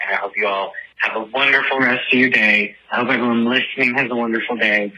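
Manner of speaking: ordinary delivery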